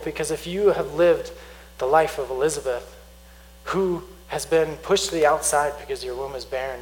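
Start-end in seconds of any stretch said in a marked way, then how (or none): none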